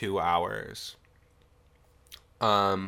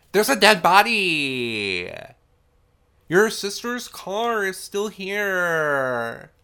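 high, loud and very slow, spelling it out; sarcastic pleading with the character; exasperated disbelief; 7 semitones higher, 7 dB louder, much slower than usual. Real, not acted. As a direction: lay out the obvious, slowly, in disbelief. Incredulous reply to the sister.

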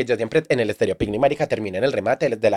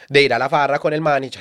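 ordinary talk

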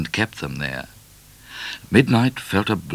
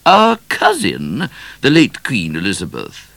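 remarking queen; 7 semitones higher, 8 dB louder than usual. A narrator's reading. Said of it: "she remarked".

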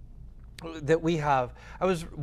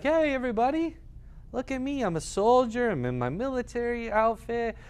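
higher, showing off for the crowd; mock show-off; 6 semitones higher than usual. Preacher mocks a soldier seeking praise, in a mocking high voice. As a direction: play a soldier begging for attention, mockingly. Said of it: "It wasn't like".

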